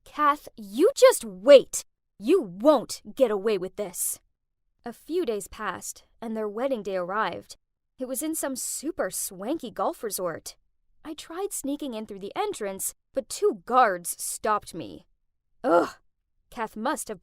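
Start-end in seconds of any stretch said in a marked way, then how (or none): 0.0-4.2 loud, slow threatening shout; furious, emphatic yell; angry shout. A narrator's reading. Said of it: "so I shouted at her". 15.6-15.9 short, annoyed ugh; disgusted huff; frustrated groan. Acted. Frustrated at being stopped by guards.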